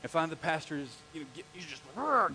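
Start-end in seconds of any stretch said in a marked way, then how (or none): 1.9-2.3 short mock growl of anger; ticked-off growl. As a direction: growl as if getting riled. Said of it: "getting ticked".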